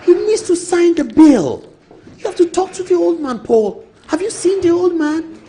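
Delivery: hammed-up pushy colleague urging; high pitched mock nagging about the bill; imitating Brother Fo. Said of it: "He told me that".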